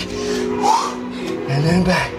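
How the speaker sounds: very slow, stretched-out cue; drawn-out cue. Shown as a demonstration. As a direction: stretch each word out long. Coach cueing the move back.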